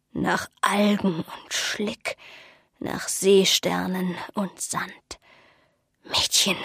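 put-on very slow, whispery old seer; uncanny old woman, hushed and drawn out; eerie pronouncement. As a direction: breathe out each item slowly, almost entranced.

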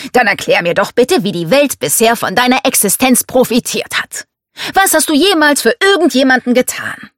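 scornful patriarch's challenge; domineering father, challenging. Acted. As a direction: demand that the daughter justify herself.

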